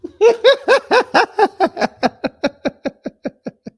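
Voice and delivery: high, loud burst of mocking laughter; big mocking laugh. Real, not acted. Laughs at candidate's answer.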